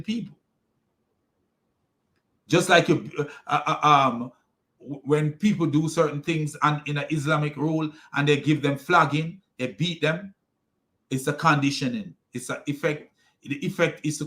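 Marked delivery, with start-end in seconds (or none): none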